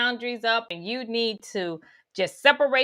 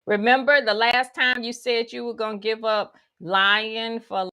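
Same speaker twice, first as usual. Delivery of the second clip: ordinary talk, nothing marked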